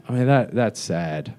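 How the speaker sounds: ordinary talk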